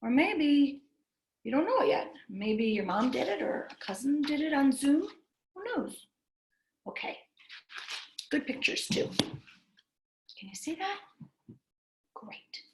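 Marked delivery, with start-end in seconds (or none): none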